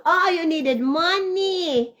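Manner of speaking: high, slow drawn-out realization; realizing aloud. Retold or imitated. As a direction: stretch it out like the penny just dropped. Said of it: "So I said".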